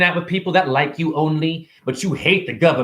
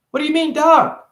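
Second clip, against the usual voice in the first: put-on higher little kid voice; 12 semitones higher than usual. A kid answering a stranger, higher small voice. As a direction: answer like a shy ten-year-old.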